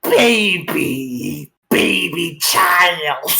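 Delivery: very slow, drawn-out mocking baby talk; sneering snake voice. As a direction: stretch each word out to belittle her. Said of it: "as he's just like".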